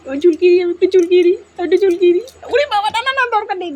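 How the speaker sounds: mock squeal playing with the dog; high playful baby talk. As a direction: squeal it like a cartoon pet. Baby-talk exchange with pet.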